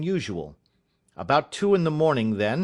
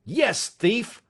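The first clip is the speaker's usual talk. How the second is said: roar; 8 semitones higher than usual. Acted. Father roaring at his son while shaking him. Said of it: "I roared".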